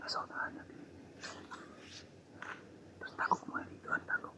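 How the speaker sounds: breathy hushed whisper. Real, not acted. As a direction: whisper while hiding. Hiding, whispering to companion. (pabulong habang nagtatago)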